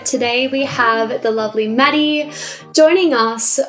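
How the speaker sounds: ordinary delivery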